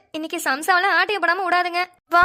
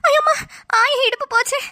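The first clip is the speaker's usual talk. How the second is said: crying out in pain; 8 semitones higher than usual. Acted. Old woman hit by a bicycle wails in pain. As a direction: cry out as if your hip just broke.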